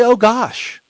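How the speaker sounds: Oh gosh said high and light; replacement exclamation. Shown as a demonstration. Preacher modelling the better word for his listeners, said high. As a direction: chirp out Oh gosh, the wholesome swap.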